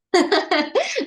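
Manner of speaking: high burst of giggling; quick high-pitched chuckle; amused laugh. Real, not acted. Teacher amused by student's attempt.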